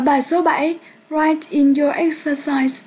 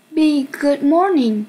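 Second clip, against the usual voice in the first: usual speaking voice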